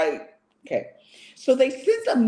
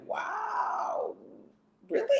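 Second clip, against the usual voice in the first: unimpressed wow; 13 semitones higher, 11 dB quieter, much slower than usual. Retold or imitated. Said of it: "you're just like".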